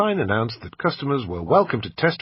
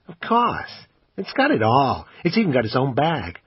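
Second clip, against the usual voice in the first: pawn shop salesman; 5 semitones higher than usual. Acted. Assistant pitching the camera to the customer, salesman voice. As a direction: sell it like a pushy shop assistant.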